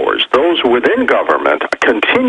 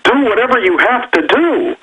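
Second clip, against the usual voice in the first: high-pitched imitation of panicked begging; panicked plea; 11 semitones higher than usual. Host voicing the public begging government to save them, high mock panic. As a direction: act out a frightened crowd pleading, pitched way up. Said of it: "panic and say".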